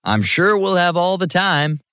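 high-pitched mock assurance; sarcastic. A narrator's reading. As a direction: pretend there's loads of time, sarcastically. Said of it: "Nero asked sarcastically".

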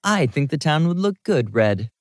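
mildly teasing; joking. A narrator's reading. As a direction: toss it in as a playful joke. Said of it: "I joked".

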